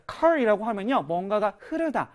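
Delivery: usual speaking voice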